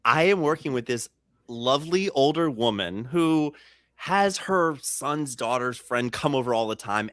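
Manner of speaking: ordinary talk, nothing marked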